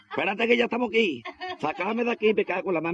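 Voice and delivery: lightly voiced rescuer calling down; voiced workmate.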